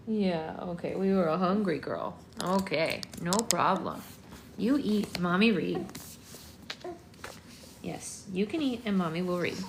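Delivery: ordinary talk, nothing marked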